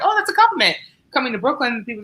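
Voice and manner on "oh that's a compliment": high-pitched mock-delighted little voice; naively pleased. Writer mimicking how she once took a microaggression, naively pleased. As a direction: imitate your naive younger self, pitched way up.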